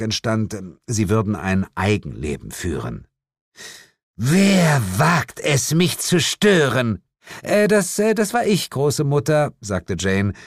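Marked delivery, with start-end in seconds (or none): 4.2-7.0 high, slow and drawn out; an angry witch queen demanding who dares intrude; imperious demon mother. Acted. 7.4-9.5 high, hesitant apology; apologetic. A narrator's reading. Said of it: "lächelte entschuldigend".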